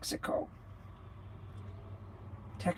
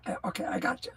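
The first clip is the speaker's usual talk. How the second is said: ordinary talk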